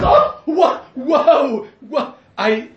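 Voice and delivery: high, loud startled outburst; shocked cry. Retold or imitated. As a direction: yelp in shock as the baby pops out. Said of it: "She says".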